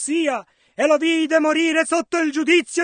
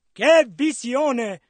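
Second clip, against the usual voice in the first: ordinary talk, nothing marked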